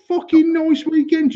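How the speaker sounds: slow, very high incredulous parroting; mocking echo. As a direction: throw the greeting back in mock falsetto. Sarcastic echo of the greeting.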